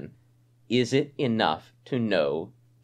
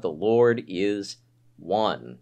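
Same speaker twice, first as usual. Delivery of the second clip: each word slow and weighty; slow right down on the Lord is one; slow solemn Shema; 3 semitones lower, much slower than usual. Shown as a demonstration. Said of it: "Remember, "Hear, O Israel".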